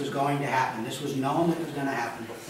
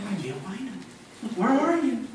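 a puzzled, higher seeker who truly can't find them; mock lost searcher; 7 semitones higher than usual. God not searching helplessly, mock puzzled call. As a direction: play God as lost, mildly hammed up. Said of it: "it's not like he was walking through the garden going".

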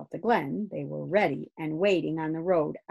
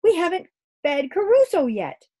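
higher and louder, urgent; excited girl; 12 semitones higher, 8 dB louder than usual. Acted. Kirsty to Grumble about the pet, urgent and high. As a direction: blurt it out like an eager girl. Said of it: "she cried".

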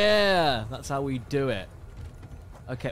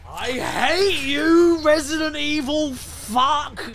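drawn-out angry curse; 11 semitones higher, 6 dB louder, slower than usual. Real, not acted. Cursing the game, drawn out.

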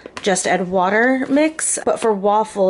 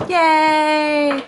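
excited cheer; 8 semitones higher, 6 dB louder than usual. Real, not acted. Excited cheer about waffles.

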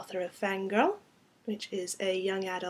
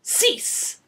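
put-on high, sharp French-accented command; dramatic French-accented order; 13 semitones higher, 8 dB louder than usual. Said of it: "meant to have a very exotic French accent".

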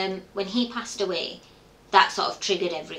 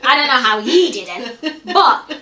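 laughing; 3 semitones higher, 10 dB louder, slower than usual. Real, not acted. Still laughing.

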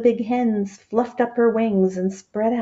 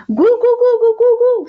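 very high, loud hen call; high sing-song farmyard call; calling loudly; 8 dB louder than usual. Acted. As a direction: call the hen at the top of your lungs. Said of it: "she called at the top of her voice".

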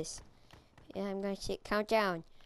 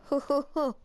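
mock laugh; 4 semitones higher, 4 dB louder than usual. Mock Santa laugh at game.

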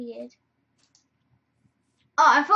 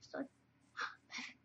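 whisper; 13 dB quieter, much faster than usual. Real, not acted. Excited whisper in game.

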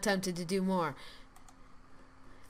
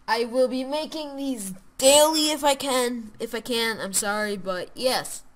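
loud, upbeat YouTube outro voice; 4 semitones higher, 10 dB louder than usual. Acted. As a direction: sign off big and cheerful to the viewers. Loud cheerful outro to viewers.

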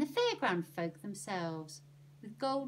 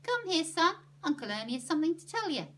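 high, loud, brisk summons; raised, hurried come-here call; calling over; 9 semitones higher, 8 dB louder, faster than usual. A narrator's reading. Annie calls Stan over.

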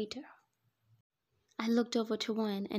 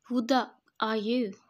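loud, raised bark; barked demand; 2 semitones higher, 8 dB louder than usual. A narrator's reading. Man confronts the intruder at the wedding, barking. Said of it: "he barked".

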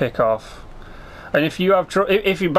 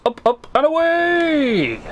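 up, and away, much higher and drawn out; gleeful cry; 12 semitones higher, slower than usual. Pretend. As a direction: sing it out like a superhero taking off. Launching in hot air balloon.

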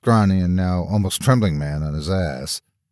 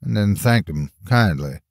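ordinary delivery, nothing marked

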